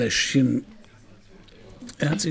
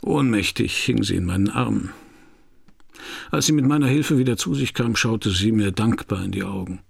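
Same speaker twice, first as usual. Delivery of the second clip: louder, steady voice-over over music; noir voice-over narration; 4 semitones lower, 5 dB louder than usual. A narrator's reading. Detective recalls meeting Jeanne, voice-over narration. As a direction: narrate it like a hard-boiled detective looking back.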